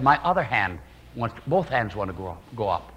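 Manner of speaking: low, quiet worried voice; a scared wife in labor, barely audible; faint frightened wife. Retold or imitated. Wife tells husband her hands rise, quiet and frightened.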